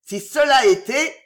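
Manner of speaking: high, loud disbelief; furious outburst. A narrator's reading. Enraged son doubts the news. (éclat de colère incrédule)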